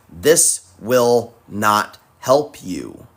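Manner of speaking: This will not help you, each word slowly and firmly; slow, deliberate emphasis. Real, not acted. Host warning viewers who rage in arguments, slow and firm. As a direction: lay every word down slowly so it sinks in. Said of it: "I need you to understand this and remember this".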